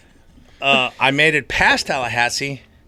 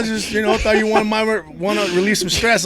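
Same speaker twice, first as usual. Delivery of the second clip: imitating the creep in a higher pitch; a higher, wheedling mock voice of the stranger; pushy creepy guy; 10 semitones higher, 6 dB louder than usual. Creepy guy's pushy offer retold.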